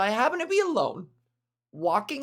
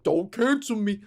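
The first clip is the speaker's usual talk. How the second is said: mocking imitation; 3 dB louder than usual. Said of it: "People be like".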